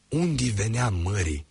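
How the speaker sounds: usual speaking voice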